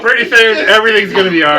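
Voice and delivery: excited. Real, not acted. Joking while laughing.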